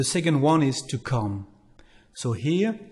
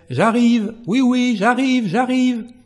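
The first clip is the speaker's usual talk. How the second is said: high, loud, answering someone who calls; calling out j'arrive; 10 semitones higher, 6 dB louder than usual. Shown as a demonstration. Teacher acting someone calling back they are on the way, high and loud. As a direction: call out as if hurrying to the door. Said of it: "you will say".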